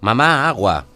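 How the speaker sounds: put-on high, loud child's call for water; a little boy yelling for his mother; child shouting. A narrator's reading. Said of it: "grita la voz de su hijo". (un niño pequeño grita a su madre pidiendo agua)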